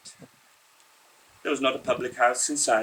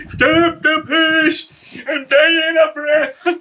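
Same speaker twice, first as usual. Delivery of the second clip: much higher, slow, bleating cry; billy goat cry; 6 dB louder, slower than usual. A narrator's reading. Mister Weasley begging the driver to stop, bleating cry. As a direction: bleat it out like a coughing old goat. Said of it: "cough like a billy goat and cry".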